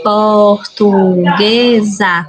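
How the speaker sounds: "Portuguesa" slowly as por-tu-gue-sa; syllable by syllable. Shown as a demonstration. Teacher showing four syllables, syllable by syllable. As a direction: stretch each syllable of "Portuguesa". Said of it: "tem quatro sílabas".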